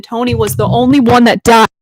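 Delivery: loud, aggrieved protest; emphatic outcry. Real, not acted. Fan protesting her favorite character's death, loud outcry. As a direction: belt it out in fan outrage.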